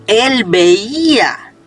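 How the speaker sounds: VEÍA drawn out very slowly with a swooping tune; emphatic stress. Shown as a demonstration. Preacher pointing out he once saw, slow emphasis on "veía". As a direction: stretch "veía" long to underline it. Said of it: "Ojo con esto".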